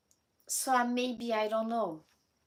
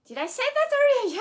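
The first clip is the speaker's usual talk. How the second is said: startled aside; 11 dB louder, much faster than usual. Real, not acted. Sudden aside to viewers.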